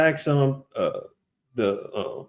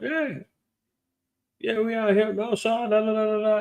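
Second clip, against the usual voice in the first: careless bragging; 6 semitones higher, 3 dB quieter than usual. Retold or imitated. Retelling Jake's carefree brag.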